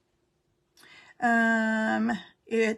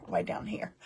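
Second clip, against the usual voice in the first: playful whisper; 7 dB quieter than usual. Pretend. Host echoing the camera move to viewers, whispered. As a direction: drop to a whisper on the repeat.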